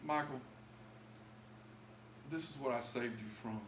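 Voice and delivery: soft, low, hushed divine voice; a quiet voice from above telling him; the Lord speaking softly. Retold or imitated. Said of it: "the Lord was telling me".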